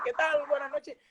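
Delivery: high-pitched stage hello; show host greeting. Retold or imitated. Freddy opening the show to music, show host greeting. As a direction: greet the house in a high, put-on voice.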